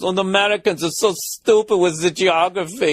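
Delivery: high, mocking put-on Danish accent; hammed-up Danish accent, needling; passive-aggressive Danish cabbie. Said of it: "cab driver turns around and goes ... Try to be passive-aggressive".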